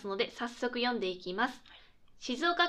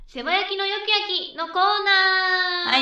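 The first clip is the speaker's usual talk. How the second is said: loud, slow, higher title shout; corner title call; 8 semitones higher, 10 dB louder, slower than usual. Acted. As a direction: belt out the segment name like a radio jingle.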